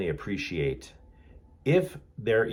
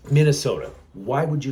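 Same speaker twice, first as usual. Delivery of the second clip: exasperated outburst; 5 semitones higher, 6 dB louder than usual. Real, not acted. Exasperated outburst about Minnesota.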